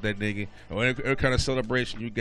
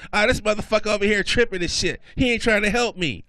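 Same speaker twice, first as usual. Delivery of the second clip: loud, high-pitched angry complaint; acted high, loud fuming; mad girlfriend; 11 semitones higher, 8 dB louder than usual. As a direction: rant indignantly as an annoyed girlfriend. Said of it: "she was all mad, talking shit to the clerk".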